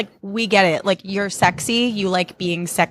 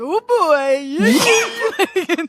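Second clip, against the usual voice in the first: high, drawn-out mock whoop; high-pitched hammed-up reaction; mock excited Jesse; 11 semitones higher than usual. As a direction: squeal it like a leering admirer. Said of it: "And then Jesse's like".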